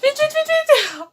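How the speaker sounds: high pained cry, mouth on fire; panicked yelp. Real, not acted. Burning mouth from spicy chips.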